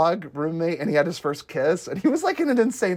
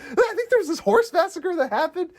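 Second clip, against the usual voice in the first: higher, lightly voiced dopey character; acted quote; 12 semitones higher than usual. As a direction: say it like a clueless treasure hunter. Acting out Charlie quote.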